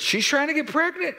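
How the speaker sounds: high, startled disbelief; surprised question. Retold or imitated. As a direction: shoot way up in astonished surprise. Learns daughter wants a baby.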